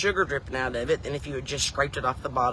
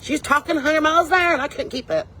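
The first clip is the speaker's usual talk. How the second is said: high, loud outburst; loud, high-pitched exasperation; overwhelmed exclamation; 10 semitones higher, 5 dB louder than usual. Retold or imitated. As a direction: pitch it way up and push it out. Acting out overwhelming fast-talking aunt.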